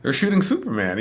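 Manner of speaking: high, eager retold announcement; imitating excited locals. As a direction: share big local news eagerly. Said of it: "people in Cleveland being excited".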